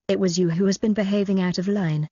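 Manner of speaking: ordinary delivery, nothing marked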